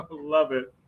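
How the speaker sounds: usual speaking voice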